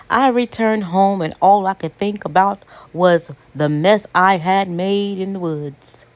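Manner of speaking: usual speaking voice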